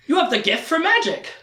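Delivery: high, wonderstruck mock revelation; flabbergasted. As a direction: repeat it in hammed-up amazement. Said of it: "like flabbergasted".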